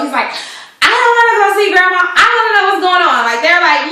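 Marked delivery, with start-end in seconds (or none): 0.8-3.2 put-on high, fast kid voice; mock child whine; whiny little boy. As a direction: play the upset little boy, pitched up. Kid voiced in retold TV scene.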